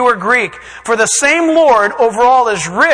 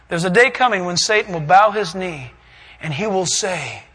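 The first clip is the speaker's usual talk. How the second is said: ordinary talk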